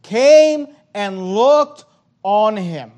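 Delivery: "came and looked" very slow and loud; emphatic reading. Shown as a demonstration. Preacher stressing the Levite's look to the church. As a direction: stretch out "came and looked" with weight. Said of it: "notice the emphasis".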